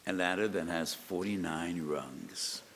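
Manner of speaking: ordinary talk, nothing marked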